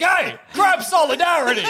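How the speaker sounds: high shouted crab greeting; random crab voice. Retold or imitated. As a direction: yell it like an excited little crab. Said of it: "being like".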